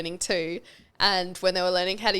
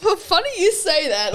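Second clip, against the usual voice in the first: coy playful reveal; 10 semitones higher, 5 dB louder than usual. Retold or imitated.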